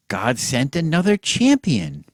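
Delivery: usual speaking voice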